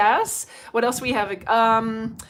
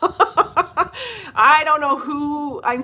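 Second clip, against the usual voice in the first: laughing; 6 semitones higher, 8 dB louder, much slower than usual. Real, not acted. Laughing while telling funny story.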